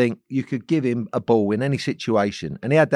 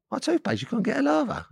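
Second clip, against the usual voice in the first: high incredulous echo; high, disbelieving "my toothpaste?"; 4 dB quieter than usual. Retold or imitated. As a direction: repeat it back in disbelief, pitched high. Disbelief at roommate.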